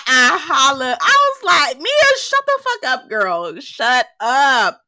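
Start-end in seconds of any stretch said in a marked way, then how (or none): 1.8-4.8 retold exasperated yell. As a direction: holler it at the screen in mock exasperation. Recalls yelling at Mia.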